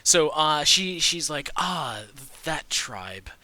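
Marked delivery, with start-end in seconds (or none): 1.6-3.3 put-on doddering old woman. Old grandmother NPC recalling a name.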